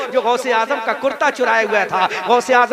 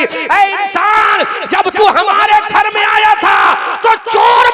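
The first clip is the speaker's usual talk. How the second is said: commanding proclamation; 7 semitones higher, 5 dB louder than usual. Acted. Saint calls out to the repentant thief, loud and commanding. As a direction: boom it out like a saint's decree.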